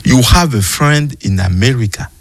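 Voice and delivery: slow, careful English with a French accent; effortful French-accented English. Real, not acted. Reading a Bible inscription aloud to the host, in effortful French-accented English. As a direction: read English haltingly through a French accent. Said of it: "je fais des efforts".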